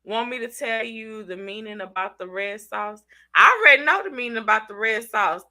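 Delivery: usual speaking voice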